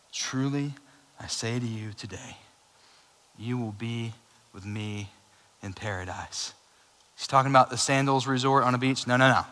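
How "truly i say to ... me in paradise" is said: softer, gentle reassurance; tender promise. Acted. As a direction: comfort a dying man. Jesus promises the criminal paradise.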